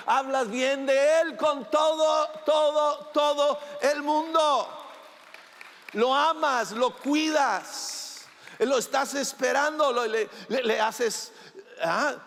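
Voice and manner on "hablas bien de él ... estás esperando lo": rising preach. Real, not acted. Preacher building emphasis.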